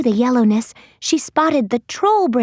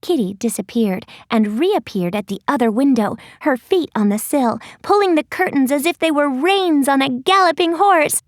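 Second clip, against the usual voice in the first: ordinary delivery, nothing marked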